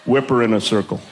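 low, deadpan villain aside; mock-sinister low voice; callous boat crew. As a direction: drop the pitch for a cold order. Boat crew's callous retort quoted.

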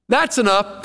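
God putting his foot down. Retold or imitated.

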